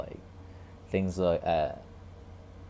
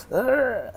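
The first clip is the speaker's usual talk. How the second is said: high, loud grunt of disgust; disgusted ugh; 5 dB louder than usual. Retold or imitated. Someone turning away from what's in plain view, disgusted groan.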